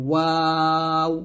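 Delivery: "ওয়াও" thin, with a long vowel; thin pronunciation. Shown as a demonstration. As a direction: draw out a light "ওয়াও". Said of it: "পাতলা".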